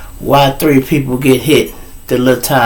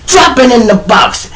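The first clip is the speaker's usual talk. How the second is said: high, forceful command; mad; 9 semitones higher than usual. Acted. Miss Washington collecting the belts, mad high command. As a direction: order it with no argument allowed. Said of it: "she was mad".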